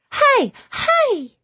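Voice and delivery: put-on high chirpy baby voice; baby girl greeting Eve; toddler greeting. A narrator's reading.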